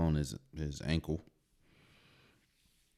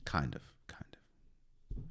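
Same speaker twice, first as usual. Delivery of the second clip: whispered aside; 5 semitones lower, 10 dB quieter, slower than usual. Real, not acted. Muttered hedge to himself.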